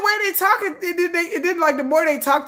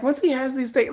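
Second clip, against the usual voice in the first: normal talking voice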